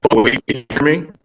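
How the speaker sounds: choppy, static-filled connection; breaking up. Real, not acted. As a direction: voice cutting in and out. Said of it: "you're breaking up every other sentence".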